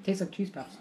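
talking between bites; chewing while talking. Real, not acted. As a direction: mouth partly full. Seen 0: Woman speaking holds a puff by her mouth, appears to be chewing.

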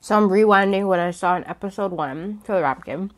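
speaking between bites; talking while chewing. Real, not acted. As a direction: mouth partly full. Seen 1: Bit roll at -9, chews before; lips press mid-speech at 2.3.

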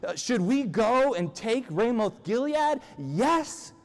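high, eager mock question; enthusiastic asking. King polls his gathered yes men, enthusiastically. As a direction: ask as if only yes will do. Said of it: "he enthusiastically asks them".

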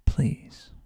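normal talking voice

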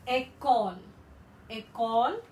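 école with a hard K, ay-KOL; French pronunciation. Shown as a demonstration. Teacher models école for students, a crisp hard K. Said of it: "the C will be pronounced as K".

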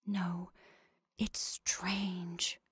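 soft breathy whisper; barely voiced, almost only breath. A narrator's reading. Sheriff's wife awed by the killing, breathed whisper. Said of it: "breathed Missus Peters".

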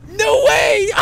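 high, loud thrilled yell; real gleeful yell of amazement; excited shout. Real, not acted. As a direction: shout it in delighted disbelief.

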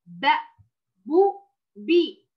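slow, clear short ب, بو, بي; short sounds again. Shown as a demonstration. Teacher repeats the short sounds, clearly. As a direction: say the short syllables one by one. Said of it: "مرة أخرى".

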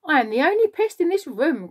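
high, loud, gleeful boast; boastful cry. A narrator's reading. Centipede bragging to the whole room, crying out. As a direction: cry it out with pride. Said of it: "cried the centipede".

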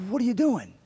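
high-pitched imitation of a taken-aback usher; startled usher. Usher to boy stepping into the plate, startled. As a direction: imitate someone caught off guard, pitch jumping up.

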